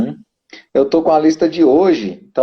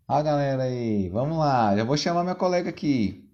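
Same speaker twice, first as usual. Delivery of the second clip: ordinary talk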